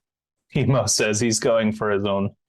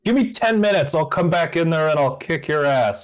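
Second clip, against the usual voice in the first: high-pitched defiant yell, held in volume; mock yell of a threat; yelling back; 7 semitones higher than usual. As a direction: shout back a boast, starting high. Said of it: "yell back at the top of your lungs".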